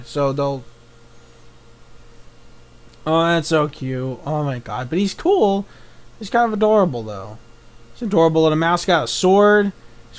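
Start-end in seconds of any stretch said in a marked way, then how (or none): none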